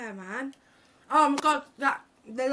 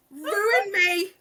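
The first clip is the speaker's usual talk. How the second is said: high, louder squealing delight; excited; 10 semitones higher, 5 dB louder than usual. Real, not acted. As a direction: cry it out thrilled. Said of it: "the scream".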